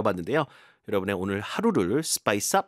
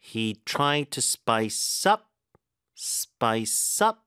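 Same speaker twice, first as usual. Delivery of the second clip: very slow, punching UP in "spice up"; deliberate teacher model, "up" lifted; stress demo; much slower than usual. Shown as a demonstration. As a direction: drill "spice up" in slow pieces, weight on "up". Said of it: "이렇게 되죠".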